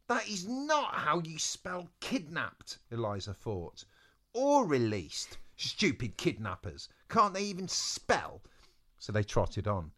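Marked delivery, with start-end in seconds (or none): 0.1-2.8 slow, higher, emphatic indignation; indignant. Acted. Eliza fumes at kidnappers' spelling, indignant. 4.3-8.4 exasperated, higher on key words; scornful complaint, punched at start and end; indignant. Acted. Eliza scorns the stupid kidnappers, indignant.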